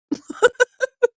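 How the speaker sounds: a high giggling burst; high squealing laugh. Real, not acted. Laughing at own story.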